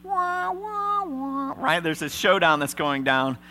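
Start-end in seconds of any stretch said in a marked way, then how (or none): 0.0-1.8 a very high, drawn-out right; squeaky right. Real, not acted. Preacher teasing the church, very high.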